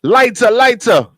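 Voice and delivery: high, slow, loud Jamaican patois; Jamaican accent. Pretend. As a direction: call it out like a Jamaican rasta. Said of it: "I love your Jamaican accent".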